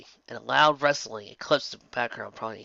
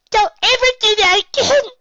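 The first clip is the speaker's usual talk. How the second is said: much higher, loud tearful plea; choked out; 10 dB louder than usual. A narrator's reading. Pinkie Pie to Applejack, loud choked plea. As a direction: squeeze it through tears. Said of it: "she choked out".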